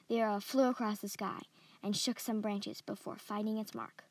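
ordinary delivery, nothing marked

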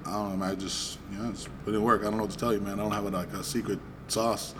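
weary brush-off. Retold or imitated. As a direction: play down the compliment quietly. Retold weary brush-off to a stranger.

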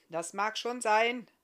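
loud, slow, grudging concession; slightly miffed grumble. A narrator's reading. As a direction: grumble it, a touch put out. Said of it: "brummte Barthamiel ein wenig verstimmt".

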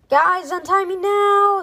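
high, loud, slow urgent shout. Acted. Alexander shouting at his friends to untie him. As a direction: yell at your friends to free you. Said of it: "shouted Alexander".

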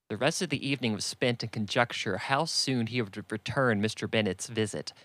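ordinary delivery, nothing marked

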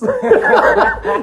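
high burst of laughter; loud laugh. Real, not acted. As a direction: crack up laughing. Laughing at locker room joke.

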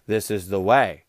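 deadpan the catchphrase low and grave; solemn Mandalorian line. Retold or imitated. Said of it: "you can use This Is the Way as anything".

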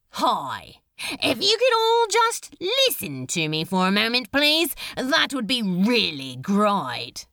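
loud, breezy address to a crowd; mock-polite announcer; cheeky public speaker. A narrator's reading. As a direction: project chummily to the whole room.

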